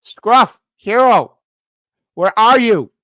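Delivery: high, loud mock call. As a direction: call them out across the room. Calling out to liberal churls.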